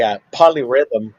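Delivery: chewing while talking; words through a mouthful; mouth full. Real, not acted. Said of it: "sorry, I'm eating salami".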